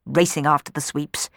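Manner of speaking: ordinary delivery, nothing marked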